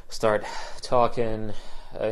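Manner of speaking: ordinary talk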